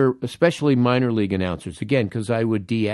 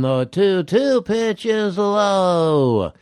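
very slow, higher, drawn-out parody announcer call; mock Vin Scully imitation; 8 semitones higher, much slower than usual. Mocking the minor league announcer's ridiculous Scully impression, very slow and higher. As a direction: ham up a minor league guy copying Scully. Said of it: "This guy was imitating Vin Scully".

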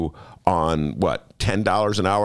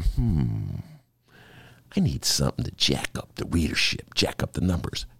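lower, softer scheming musing; scheming columnist; 8 semitones lower, 4 dB quieter than usual. Retold or imitated. Host imagines Nate Cohn plotting, low sly musing. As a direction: muse to yourself like a schemer. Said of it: "Nate Cohn was sitting there going".

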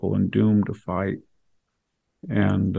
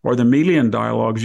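ordinary talk

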